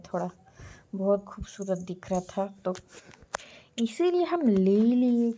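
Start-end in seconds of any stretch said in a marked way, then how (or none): 3.8-5.3 louder, higher, playful insistence; playful justifying. Real, not acted. Telling viewers why she took it, playful justifying. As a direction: swoop up high and loud in self-justification.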